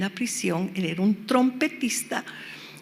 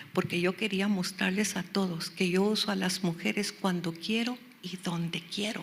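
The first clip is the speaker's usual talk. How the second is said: soft, slowing down and fading; God's quiet answer; 2 semitones lower, 8 dB quieter than usual. Retold or imitated. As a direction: let the Lord's reply sink almost to a whisper. Voices God answering her in thought.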